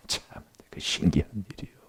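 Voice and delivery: whisper. Real, not acted. Whispered aside.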